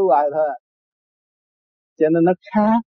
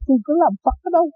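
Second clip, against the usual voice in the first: incredulous retort; 10 semitones higher than usual. Retold or imitated. Retorting to Father Kim.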